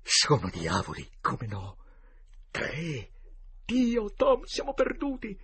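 hushed start rising to a high, loud cry; shuddering fear. A narrator's reading. Huck believing devils are coming, fear mounting to despair. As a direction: build from shudder to panic. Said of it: "Huckleberry sussurrò con un brivido".

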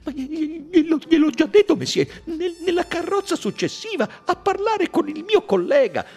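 high and loud, tripping over each word; stammering panic. Acted. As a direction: stutter out the alibi in a panic. Violenta agitazione.

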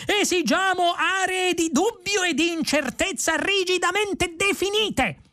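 very high, strained proclamation; excitable indignant protester; yelled demand. A narrator's reading. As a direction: hold the whole demand on a high screechy pitch. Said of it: "urlò Vrumfondel".

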